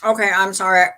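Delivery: talking through a mouthful of chocolate; chewing while speaking; mouth full. Real, not acted. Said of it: "My mouth is full of chocolate again".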